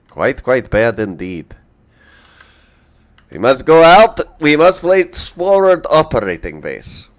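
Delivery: put-on loud hammy Russian accent; playful mock Russian voice. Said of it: "As to why I'm doing a Russian accent".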